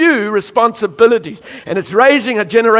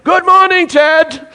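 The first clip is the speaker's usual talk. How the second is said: high, loud singsong chorus reply; congregation greeting; 10 semitones higher, 5 dB louder, slower than usual. Retold or imitated. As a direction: call back like a cheerful crowd. Congregation answering preacher.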